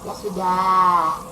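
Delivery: low, slow, softening king; relenting king. Acted. King giving in to the crying servant, low and gentle. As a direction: ease down into mercy.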